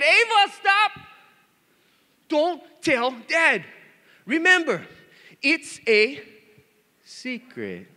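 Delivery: high, loud cry slowing to measured words; mock scolding wife. Wife stopping daughter spilling the gift, mock scolding.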